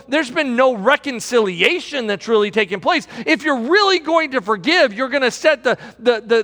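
usual speaking voice

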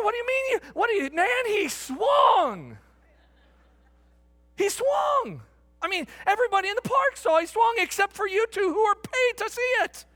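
high, loud, exasperated shouting; retold outraged protest. Fan arguing the missed swing with the umpire, shouting in outrage. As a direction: protest at the top of your range, drawing out "swung".

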